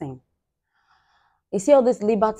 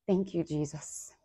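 soft prayer; 10 dB quieter than usual. Real, not acted. Whispered prayer of thanks.

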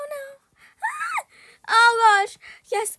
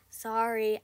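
ordinary talk

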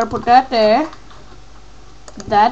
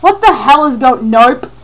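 loud, raised-pitch alarm; startled outburst; 3 semitones higher, 9 dB louder than usual. Real, not acted. Player startled in game, loud alarm. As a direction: blurt it out and back off.

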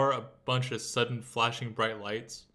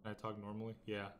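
soft, careful test of the mic; cautiously quiet; 11 dB quieter than usual. Real, not acted. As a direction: ask it very softly, testing. Testing the volume threshold softly.

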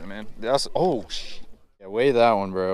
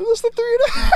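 much higher excited call; 4 dB louder, faster than usual. Real, not acted. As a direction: call it out thrilled. Excited about the fish.

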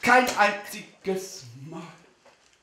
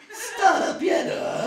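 high, slow hammed-up diva voice; sexy star voice; 14 semitones higher, much slower than usual. Star answering the starstruck fan, mock sultry. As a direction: purr it like a pop star. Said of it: "dass sie zwölftausend Euro".

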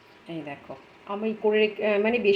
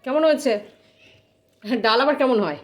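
louder, higher asking across the room; calling out; 4 semitones higher, 7 dB louder than usual. Real, not acted. Mother asks family about the dal, raised call.

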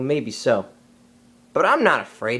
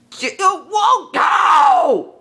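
sudden high yelp, louder; startled shout; 9 semitones higher, 5 dB louder than usual. Acted. Mowgli yelping as he slips from the branch, startled shout. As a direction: cry out as you lose your grip. Mowgli slipping while climbing tree.

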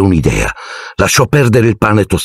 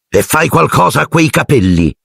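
shrill aunt; 9 semitones higher than usual. A narrator's reading. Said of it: "zia Petunia prese a strillare".

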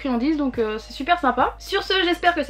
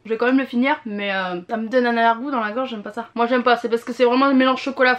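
chewing while talking; muffled through chocolate; mouth full; 3 semitones lower than usual. Real, not acted. Seen 2: Bites cube at -3; talks with lips pressed, holds leftover piece.

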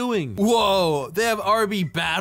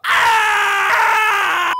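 sudden high loud yell as the potion hits; pained scream; 12 semitones higher, 7 dB louder than usual. Acted. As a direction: scream out in shock. Drinks acid potion, screams.

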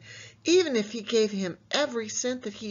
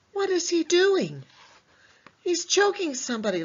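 shocked, high; 9 semitones higher, 4 dB louder than usual. Acted. Shocked by choking picture.

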